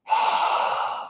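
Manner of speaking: open-mouthed breathy haa from the throat, like fogging a mirror; fogging breath. Shown as a demonstration. Teacher demonstrating mirror-fogging exhale to class: throaty haa. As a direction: breathe out a throaty haa onto a cold window. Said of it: "you're gonna fog the mirror with your breath".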